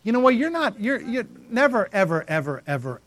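usual speaking voice

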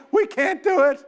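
"We can't do it" pushed up high and whining; whiny high repeat. Retold or imitated. Preacher mimics the frightened spies, high whine. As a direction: squeak the second "We can't do it" like a scared spy. Spies' report, second take higher.

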